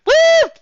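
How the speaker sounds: loud, very high slow whoop; sudden whoop. Acted. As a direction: burst out with a whoop. Sudden whoop mid-narration.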